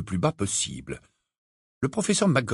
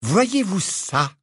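slow, haughty put-down; 6 semitones higher, much slower than usual. A narrator's reading. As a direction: look down on her as you ask. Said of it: "d'un air hautain".

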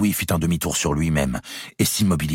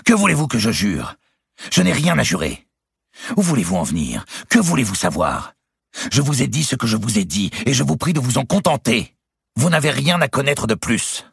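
higher and louder, defensive; raising his voice; 11 semitones higher, 4 dB louder than usual. A narrator's reading. Louis snaps back at his wife, raised voice. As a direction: push back harder line by line. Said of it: "haussant le ton".

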